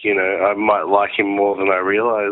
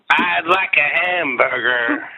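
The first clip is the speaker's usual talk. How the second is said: slow, higher-pitched put-on singer voice; 5 semitones higher, slower than usual. Rock singer ordering a hamburger, slow hammed-up voice. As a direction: drawl it out in a rock singer impression. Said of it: "I'll do my [name] impression".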